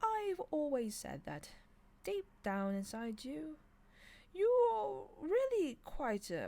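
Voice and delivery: high, slow, fond angel voice, swelling; drawing it out sweetly as it builds; a delighted angel praising the demon, high and slow; beaming. Acted. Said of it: "he said, beaming".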